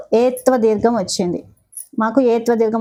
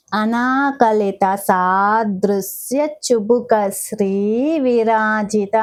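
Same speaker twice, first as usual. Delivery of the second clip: the whole name drawn out, ధర lengthened at the end; slow careful recitation; much slower than usual. Shown as a demonstration. Teacher modelling the full name for students, slow. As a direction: recite it slowly, stretching the last syllable. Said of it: "ఎలా practice చేసుకుంటున్నానో మీకు మాత్రం చూపిస్తున్నాను".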